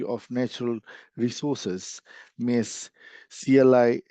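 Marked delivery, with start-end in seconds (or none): none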